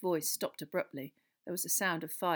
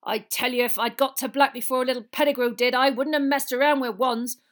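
loud, raised, threatening growl; angry growl; 7 semitones higher, 14 dB louder than usual. A narrator's reading. Giant vows what he'd have done, growling. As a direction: build to a furious threat. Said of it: "Hagrid growled".

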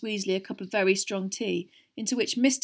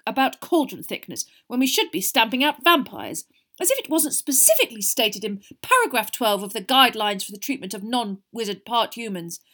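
indignant rant; 3 semitones higher, 9 dB louder than usual. A narrator's reading. Percy ranting on.

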